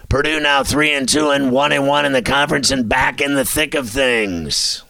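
ordinary delivery

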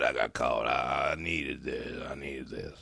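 low, soft and slowing; hushed, beaten-down mock gratitude; mock defeated confession. Caught man admitting he needed this, mock defeated.

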